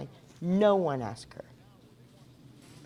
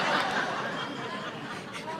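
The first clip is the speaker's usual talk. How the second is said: airy real laugh with no words; breathy laugh; 4 dB quieter than usual. Real, not acted. Laughs after retold joke.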